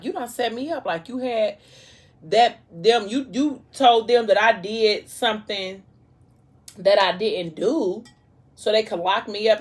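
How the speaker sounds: lightly acted furious man confronting her; angry accusation. Intruder accuses pregnant ex of framing him, heated.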